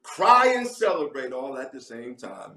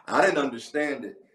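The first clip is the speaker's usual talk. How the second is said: usual speaking voice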